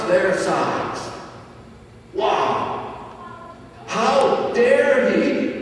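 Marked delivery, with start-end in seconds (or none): 2.1-5.5 high, slow, scandalised gasp of offence; indignant outcry. Retold or imitated. Crowd outraged at Jesus, a slow, high indignant cry. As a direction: play the shocked crowd, drawn out and affronted. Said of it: "they say".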